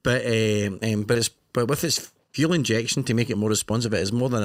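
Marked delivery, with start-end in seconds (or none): none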